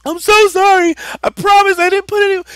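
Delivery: hammed-up wail, very high and loud; imitating a bawling little brother; crying. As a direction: beg through mock sobs. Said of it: "but he starts crying".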